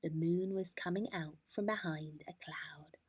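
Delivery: plain reading voice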